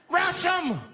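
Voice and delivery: high, loud charge command; screamed rallying cry. Retold or imitated. Snapped passenger urges the bus to attack, screaming. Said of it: "He screamed out".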